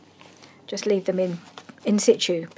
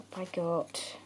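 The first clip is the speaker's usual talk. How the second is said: ordinary talk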